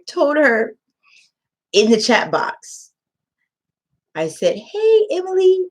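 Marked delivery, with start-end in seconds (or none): 4.6-5.7 cheery teacher greeting. Retold or imitated. Greeting student in chat.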